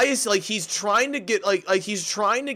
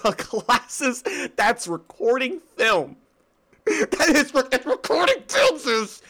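higher, giggly disbelief; laughing while talking; 6 semitones higher than usual. Real, not acted. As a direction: fight through giggles to get it out. Giggles over the absurd camera glasses.

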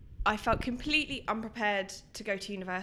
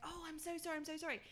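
higher and much softer, a hushed apologetic voice; mock apology; 8 semitones higher, 16 dB quieter, faster than usual. Woman behind them on the plane apologising to the boyfriend, hushed and high. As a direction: lift it up and breathe it out as a fussy sorry.

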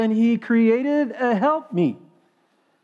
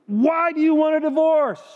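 much higher, fed-up lawyer's question; exasperated attorney; 8 semitones higher than usual. Retold or imitated. Attorney finally loses patience with his client, exasperated. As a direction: throw up your hands and ask it at last. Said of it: "he's getting exasperated at this point".

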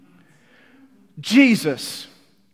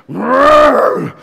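a very loud roaring cry of an animal; 7 semitones higher, 13 dB louder than usual. Shown as a demonstration. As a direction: roar like a beast that cannot stand death. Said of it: "I'm not even gonna do an impression".

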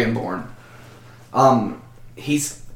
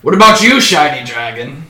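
mocking interrogator; 5 semitones higher, 5 dB louder than usual. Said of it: "and he says".

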